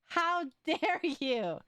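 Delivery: high, slow hammed-up indignation; mock outrage. Real, not acted. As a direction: scold them in fake horror. Mock outrage at chat raider.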